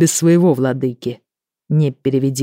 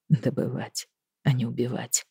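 "Добывать, а не убивать" echoed much softer, musing; thoughtful repeat; 2 semitones lower, 9 dB quieter than usual. Acted. Five softly echoes Hedvika's words, thinking them over. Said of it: "задумчиво повторил Файв".

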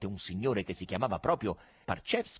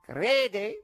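much higher mock question; sly showman retort; conjurer's taunt; 11 semitones higher, 5 dB louder than usual. A narrator's reading. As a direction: challenge the heckler.

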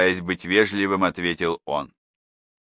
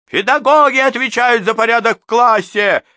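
much higher, louder heated counter; raised voices; 9 dB louder than usual. A narrator's reading. Second mother argues back at the teacher, loud. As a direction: throw the rule right back. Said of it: "на повышенных тонах".

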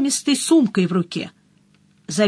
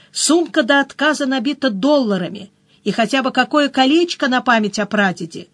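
ordinary delivery, nothing marked

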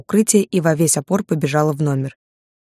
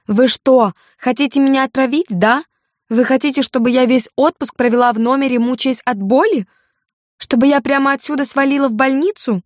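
high-pitched, indignant tirade; aggrieved diner, voice pitched way up; angry scolding; 9 semitones higher than usual. A narrator's reading. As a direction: lay into the waiter with escalating complaints. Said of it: "сердито выговаривала она официанту".

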